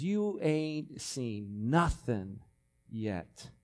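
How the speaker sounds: slow drawn-out slang, voice dropping; slang paraphrase. Shown as a demonstration. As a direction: stretch it out casually, street-style. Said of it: "the slang way that we would say that is".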